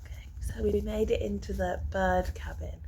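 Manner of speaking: hushed whisper in the bird hide; wildlife watching whisper. Real, not acted. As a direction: whisper so the birds stay. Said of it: "We have to be quiet".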